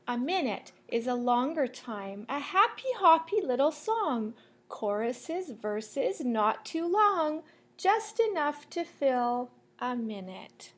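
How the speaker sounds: lilting sing-song picture-book read; reading to children. Acted. Teacher reading a rhyming book to the class, sing-song. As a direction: read the rhyme to the class, bouncing.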